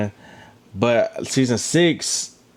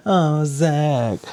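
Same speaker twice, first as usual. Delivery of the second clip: higher-pitched cooing imitation; put-on higher voice, teasing the couple; mock couple banter; 4 semitones higher, much slower than usual. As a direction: mockingly draw out a lovey-dovey name. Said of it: "the banter between y'all is just weird".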